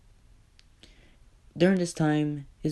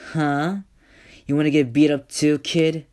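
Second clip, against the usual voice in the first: loud, higher, fast mocking threat; thug's threat; 6 semitones higher, 8 dB louder than usual. Thug taunts Izuku and the kid, loud sneering threat.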